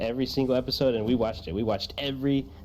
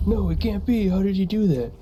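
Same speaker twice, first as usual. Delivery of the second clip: shocked; 7 semitones higher, 4 dB louder than usual. Pretend. Shocked duelist.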